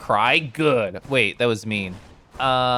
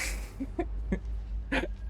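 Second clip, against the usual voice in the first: breathy laugh; 9 semitones higher, 13 dB quieter than usual. Real, not acted. Whispered laugh.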